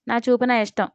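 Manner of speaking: usual speaking voice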